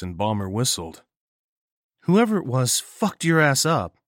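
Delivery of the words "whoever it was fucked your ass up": higher, louder, other character. Acted. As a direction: give Bomber a different voice. Bomber remarking.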